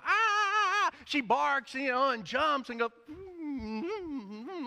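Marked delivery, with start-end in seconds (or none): 0.0-1.0 high, loud yapping puppy barks; puppy yips. Retold or imitated. Preacher imitating his puppy greeting him, high loud yips. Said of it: "She goes". 3.1-4.7 high, soft whimpering puppy; puppy whines. Retold or imitated. Preacher showing how his puppy whines at the door, high soft hums. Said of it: "like that".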